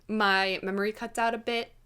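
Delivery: usual speaking voice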